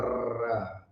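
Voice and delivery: र held on as one long, continuous r sound; sustained र, low and steady. Shown as a demonstration. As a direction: draw out र without a break. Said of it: "उच्चारण जो है सो continued होता है".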